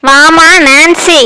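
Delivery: slow, sugary call with a threat under it; mock-sweet summons. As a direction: lure her forward with false sweetness. Teacher calls failing student forward. (மெதுவான போலி இனிமையுடன் அழைப்பு)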